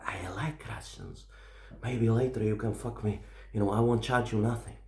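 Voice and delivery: low, hushed come-on; imitated seductive murmur. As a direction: proposition him discreetly, soft and low. Woman propositions him in passing.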